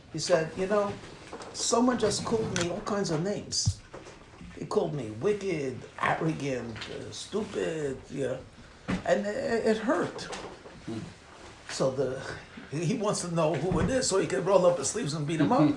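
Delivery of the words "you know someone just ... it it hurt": soft, subdued retelling of a hurt; upset. Retold or imitated. Hurt rabbi tells a student about being called names, soft and downcast. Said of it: "clearly upset about something".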